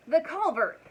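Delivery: high, loud cry of discovery; triumphant yell. A narrator's reading. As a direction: yell it as you spot the hiding place. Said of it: "yelled Mister Wagon Wheel".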